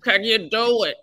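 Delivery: slow, drawn-out impression of someone else; Penny Marshall. Comedian recalling a director asking for weight loss, slow mimicked voice. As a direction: put on a voice, stretching the words slowly. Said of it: "I remember Penny Marshall going".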